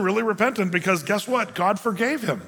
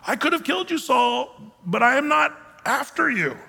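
higher-pitched call across a distance; 7 semitones higher than usual. Acted. David calling to Saul across the canyon, calling out. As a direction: call it over in measured phrases, pitched up.

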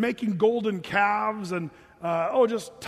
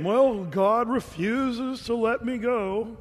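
slow, drawn-out mock complaint; reluctant; 3 semitones higher, much slower than usual. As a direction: mimic unwilling compliance, dragging every word. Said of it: "His heart's not in sync".

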